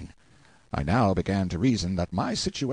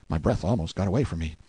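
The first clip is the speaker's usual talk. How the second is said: ordinary delivery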